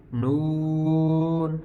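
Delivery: نون held long, kept out of the nose; non-nasal نون. Shown as a demonstration. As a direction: stretch the oo of نون without nasalizing it. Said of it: "یہ ناک میں نہیں جانی چاہیے".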